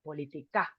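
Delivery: politica with the force on the final syllable; stress accent. Shown as a demonstration. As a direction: lean on the last "ca" of "politica". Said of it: "with more force".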